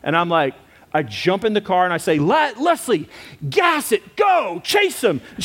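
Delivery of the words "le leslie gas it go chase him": high shouted urging to chase; retold frantic yell. Husband yelling at his wife to chase the hit-and-run driver, high frantic shout. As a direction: bark it at her like the car's escaping.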